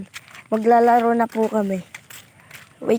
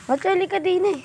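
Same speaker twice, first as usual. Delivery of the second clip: put-on much higher, childlike pitch; 9 semitones higher, faster than usual. Shown as a demonstration. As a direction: say it up high like a kid. Same line, high put-on voice.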